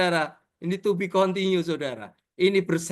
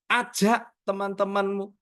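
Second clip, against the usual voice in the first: ordinary talk, nothing marked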